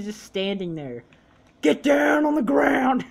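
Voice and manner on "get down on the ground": high, loud, stretched-out yell; real full-voiced shout; shouted command. Real, not acted. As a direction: yell it slowly at the players.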